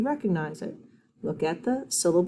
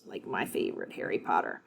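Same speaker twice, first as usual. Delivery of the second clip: whispered, much lower secret; whispered aside; 11 semitones lower, 10 dB quieter than usual. Acted. Teacher whispers her favorite book to kids. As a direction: share it like a little secret.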